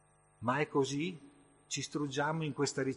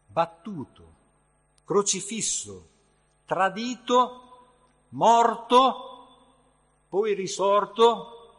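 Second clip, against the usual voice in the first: higher and much louder, slow and drawn out; rising emphatic proclamation; 6 semitones higher, 10 dB louder, slower than usual. Acted. Preacher proclaiming the death of Jesus, loud and slow. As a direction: cry out each word of the Passion. Climactic list of the Passion.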